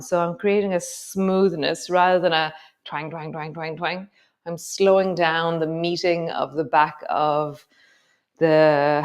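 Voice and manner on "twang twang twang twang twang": quick, repetitive twangs; mimicked twanging. Shown as a demonstration. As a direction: rattle off the twangs like a springy bounce. Mimics a jerky movement, against smoothness.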